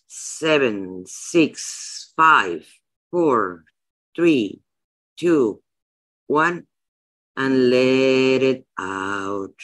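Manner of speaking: slow, soothing meditation voice with long pauses; guided meditation. Acted. Guiding her listeners through a breathing countdown, slow and calm. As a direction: ease them into the breath, one count at a time.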